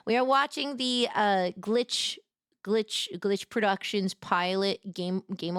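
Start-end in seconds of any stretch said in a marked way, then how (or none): none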